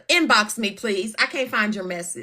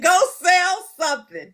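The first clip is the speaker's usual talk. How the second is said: higher, mock-stern mother's command; a light impression of her scolding mother; imitating Mama Glenda; 9 semitones higher than usual. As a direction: daughter quoting her mother's order with a laugh. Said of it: "Mama Glenn said".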